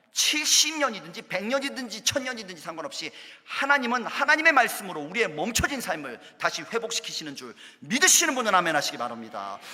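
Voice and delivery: ordinary delivery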